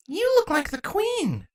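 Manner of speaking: put-on high, soft child voice; small uncertain child; little girl voice. A narrator's reading. Child nymph speaks.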